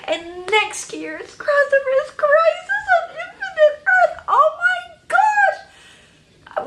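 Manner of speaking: very high, loud squeal; real fangirl screech; ecstatic freak-out. Real, not acted. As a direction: squeal the big news, stretching the last cry. Blurts out the big crossover news.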